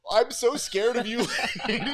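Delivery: high voice, breaking into laughter; amused mock fear. Man watching himself with screaming lady, laughing mock fear. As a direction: laugh through a pretend scared line. Retelling his reaction to screaming woman.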